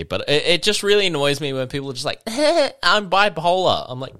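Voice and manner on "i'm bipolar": high, slow mocking drawl; mock faker. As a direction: parody the fake bipolar claim.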